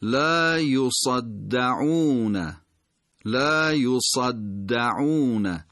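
لا یصدعون very slowly, "yuṣad-da-ʿūūna"; measured recitation of لا یصدعون, long vowels; emphasised doubled consonant. Shown as a demonstration. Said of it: "به تشدید این کلمه بیشتر".

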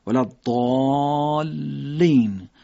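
very slow ضالین, long-held "ɔ" then doubled l; ضالین drawn right out with a held l; long madd and doubled lam. Shown as a demonstration. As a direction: stretch ضالین out on the long vowel, press the l. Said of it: "تشدیدی که روی حرف لام وجود داره".

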